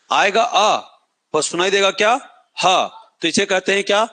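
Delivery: usual speaking voice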